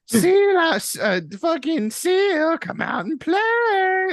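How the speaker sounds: high, slow, sing-song taunt; taunting orca voice. Retold or imitated. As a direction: coax the prey like a playful predator. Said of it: "They're flipping their fins like".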